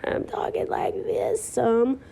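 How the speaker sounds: slow, strained croak with the voice nearly gone; allergy-strained voice. Real, not acted. Vlogger apologizing to viewers for allergies, voice cracking and failing. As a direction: voice giving out, mostly unvoiced. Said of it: "allergies have been killing me".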